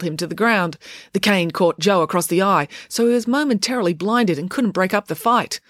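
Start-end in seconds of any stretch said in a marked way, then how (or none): none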